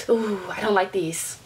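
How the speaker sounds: low, slow, soft unease; hushed, wary thought to yourself; uneasy retold aside. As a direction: say it under your breath, uneasily. Retold uneasy thought while walking.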